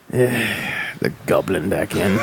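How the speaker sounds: low, slow resigned sigh. Real, not acted. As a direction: sigh it out wearily. Sighing resigned.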